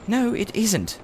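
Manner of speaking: put-on high, boyish retort; a boy insisting stubbornly; Diggory protesting. A narrator's reading. Narrator voicing Diggory arguing with Polly. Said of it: "said Diggory".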